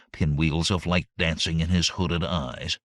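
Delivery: usual speaking voice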